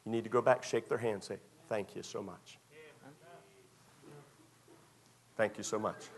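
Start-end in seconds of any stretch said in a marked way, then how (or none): 1.7-6.1 much softer, heartfelt thank-you; soft grateful thanks. Acted. Child thanking mom and dad, softly and sincerely. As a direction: murmur it with real gratitude. Kids thanking parents, preacher voicing.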